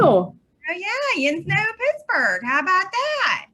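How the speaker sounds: put-on playful Pittsburgh accent, high and loud; hamming up the Yinzer twang. Guest answers host in mock Pittsburgh accent.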